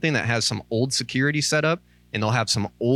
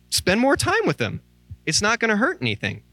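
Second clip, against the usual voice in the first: fervent preaching; 11 semitones higher than usual. Acted. Pastor urging listeners to learn God's voice, fervent preaching. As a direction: drive the point home to the congregation.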